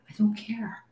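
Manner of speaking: breathy whispered aside, no voice; a breezy, whispered shrug. Real, not acted. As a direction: whisper it to yourself. Repeats it, first take whispered.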